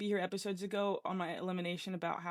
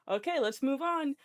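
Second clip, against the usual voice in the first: nervous brush-off; 6 semitones higher, 5 dB louder than usual. Retold or imitated. Nervous dodge of the question.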